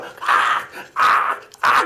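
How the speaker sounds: high wailing cries; imitated child crying. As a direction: mimic a child's wail. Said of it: "그러면서 막 우는 거예요".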